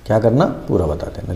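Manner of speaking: dropped quiet echo of the point; a soft, low recap for the class. Shown as a demonstration. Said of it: "teacher repeats the point".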